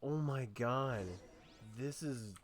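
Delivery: ordinary talk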